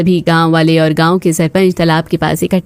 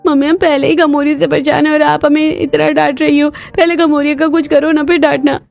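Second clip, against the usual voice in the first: put-on child protesting; 6 semitones higher than usual. Child protests being scolded.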